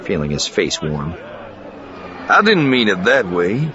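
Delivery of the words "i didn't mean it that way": protesting. Acted. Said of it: "Lando protested".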